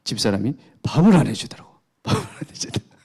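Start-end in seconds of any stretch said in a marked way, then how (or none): 1.9-2.9 laughing. Real, not acted. Preacher confessing a petty grudge to congregation, laughing. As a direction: repeat it through breathless real laughter.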